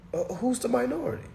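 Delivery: high, quick questioning voice; mock puzzled questioner. Streamer acting out questioning a voter, high and puzzled. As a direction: ask it fast and high, playing baffled.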